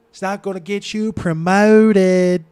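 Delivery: put-on loud, high, drawn-out Baltimore accent; hammed-up Baltimore accent, stretching "promoted"; exaggerated Baltimore accent. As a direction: belt it out in thick Baltimore with long O's. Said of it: "that fucking weird-ass accent".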